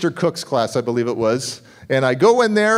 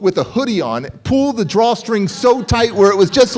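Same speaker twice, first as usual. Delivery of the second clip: normal talking voice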